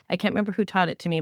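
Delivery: usual speaking voice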